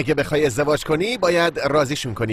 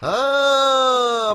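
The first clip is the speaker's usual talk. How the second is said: exasperated sigh; 8 semitones higher, much slower than usual. Acted. Exasperated sigh before sarcastic retort.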